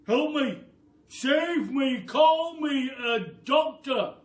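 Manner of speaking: high, loud desperate shout; yelling Mr Twit. A narrator's reading. Mister Twit yelling for a doctor, desperate shout. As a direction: yell for help at the top of your voice. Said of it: "yelled Mister Twit".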